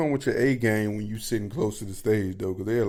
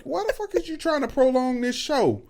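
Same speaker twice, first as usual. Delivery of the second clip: exasperated complaint; 13 semitones higher than usual. Retold or imitated. Complaining the show runs past midnight, high weary whine. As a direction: whine that it's too late.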